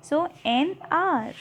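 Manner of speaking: hour said slowly as "aur" with no h; slow model of an hour, h silent; silent h demo. Shown as a demonstration. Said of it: "यहाँ पर एच silent होता है".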